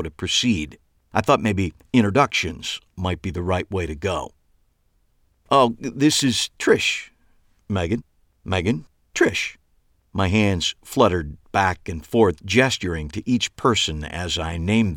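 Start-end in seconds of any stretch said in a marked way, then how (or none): 5.5-9.6 slow, fumbling nervous intro; awkward introduction. A narrator's reading. Eli introduces the two women, nervously fumbling. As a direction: stumble through names nervously.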